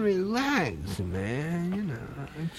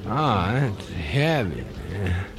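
stoned hippie voice; 9 semitones lower than usual. Acted.

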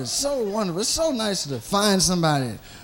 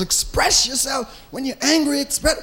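imitating his wife; 5 semitones higher than usual. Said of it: "My wife always says".

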